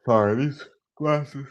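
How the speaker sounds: slow words through a yawn. Real, not acted. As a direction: talk mid-yawn, drawn out. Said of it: "I'm yawning".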